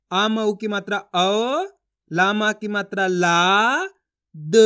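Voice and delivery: slow letter-by-letter spelling of the vowel sign औ; sounding out letters. Shown as a demonstration. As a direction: break औलाद into its sounds slowly, like a reading teacher. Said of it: "एक ही अक्षर का उच्चारण करना है".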